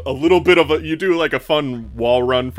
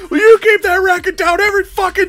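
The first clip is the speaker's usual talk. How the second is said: high, loud outraged holler; full-voiced, high-pitched angry shout; angry yelling; 7 dB louder than usual. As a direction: yell it in a high voice from down the hall. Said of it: "you hear somebody yelling".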